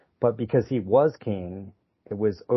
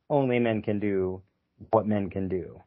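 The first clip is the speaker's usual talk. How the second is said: usual speaking voice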